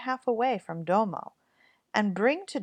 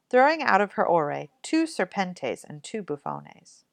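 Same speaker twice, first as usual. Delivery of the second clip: plain reading voice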